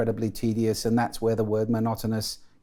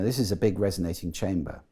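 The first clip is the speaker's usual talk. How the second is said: ordinary talk, nothing marked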